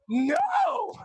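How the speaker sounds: very high, stretched-out refusal; mock terrified cry. Student at the door as guide counts, high panicked cry. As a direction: cry out in exaggerated dread.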